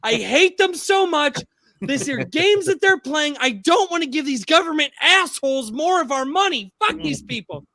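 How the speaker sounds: worked-up imitation, voice pushed way up; tearful angry rant. Quoting upset wife on government taking their money, tearful angry rant. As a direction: mimic a wife's high-pitched, loud fury. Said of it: "And she's crying. She's like".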